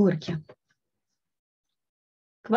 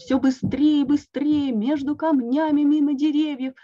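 building excitement; 6 semitones higher, 5 dB louder than usual. A narrator's reading. Narrating accelerating sled ride.

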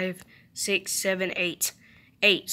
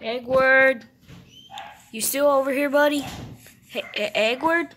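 calling out; 4 semitones higher, 4 dB louder than usual. Real, not acted. Calling pet in game.

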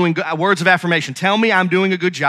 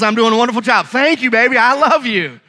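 higher-pitched, hammed-up delight; joyful; 4 semitones higher than usual. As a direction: gush happily, oblivious to the annoyance. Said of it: "I can walk away with joy in my heart".

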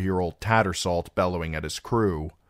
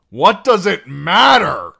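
sudden high furious scream; 12 semitones higher, 14 dB louder, slower than usual. A narrator's reading. Singer screaming at Sam in the storm-tossed cabin, furious. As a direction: scream it at him in the cramped cabin. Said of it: "Darion screamed at him once".

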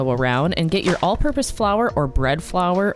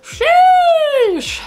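high strained sheesh; choked-up sheesh; strained exhale after smoking; 6 dB louder than usual. Real, not acted. After a big hit.

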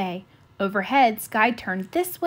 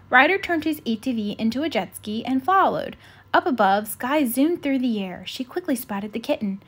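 ordinary delivery

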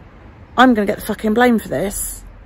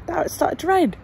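usual speaking voice

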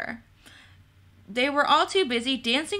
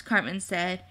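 ordinary delivery

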